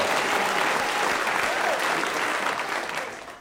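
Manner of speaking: long breathy gasps for air; panting gasps. Real, not acted. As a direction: gasp hard for breath. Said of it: "let me catch my breath".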